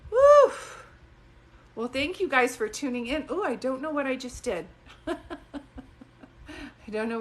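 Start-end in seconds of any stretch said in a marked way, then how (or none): 0.1-0.5 loud, very high joyful whoop; a sudden high, loud woo of delight. Real, not acted. Sudden burst after the story. 4.9-7.2 slow, laughing through the words; amused, slow laugh-talk. Real, not acted. Laughing at her own outburst.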